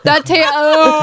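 breaking into laughter mid-word; disgusted laugh through the words. Real, not acted. Gagging on a bad jelly bean.

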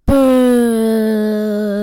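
long loud playful boo. Real, not acted. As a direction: boo the pick at full voice. Booing the rival pick.

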